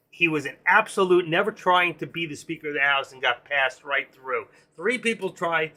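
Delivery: ordinary delivery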